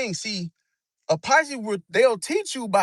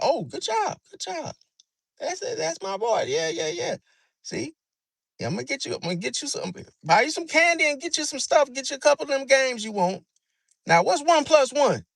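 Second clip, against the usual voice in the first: sweet praise; 7 semitones higher than usual. Retold or imitated. Pisces parent praising the kid with candy promises, sweet. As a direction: coax the kid with praise and rewards.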